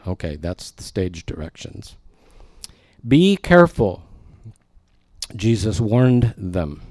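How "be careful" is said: warning. A narrator's reading. Jesus warning disciples.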